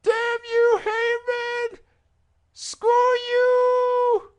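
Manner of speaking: high, slow, loud desperate wail; trapped in cement, screaming falsetto for rescue; Paul Bearer impression. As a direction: beg the Undertaker, then curse Heyman in a shriek. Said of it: "and he was just like".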